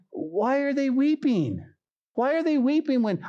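high-pitched, puzzled disbelief; baffled question. Acted. Preacher puzzling over the weeping crowd, baffled question. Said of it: "I'm looking at this going".

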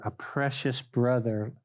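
ordinary talk

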